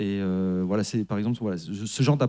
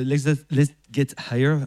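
English title in a French accent, higher pitch; 4 semitones higher than usual. Real, not acted. Musician fumbling the English album title on air, French-accented English. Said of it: "Super accent anglais".

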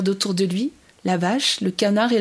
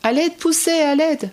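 high-pitched call for help; cry for help; 7 semitones higher than usual. A narrator's reading. Gander caught by the farmer calls Pousset, cry for help. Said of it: "un cri lui fait tourner la tête".

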